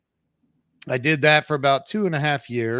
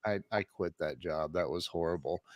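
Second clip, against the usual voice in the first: much softer, lower, halting confession; sheepish admission; 7 semitones lower, 11 dB quieter than usual. Retold or imitated. Telling his wife he quit, sheepish and soft. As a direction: mumble it like a guilty husband.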